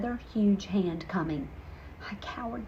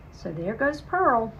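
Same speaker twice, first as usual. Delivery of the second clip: ordinary delivery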